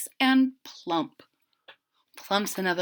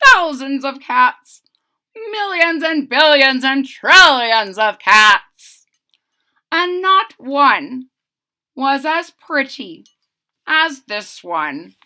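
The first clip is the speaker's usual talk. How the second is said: put-on loud, higher boastful old man; proud boast; 4 semitones higher, 8 dB louder than usual. Old man proudly boasts to wife about the cat. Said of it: "said the very old man".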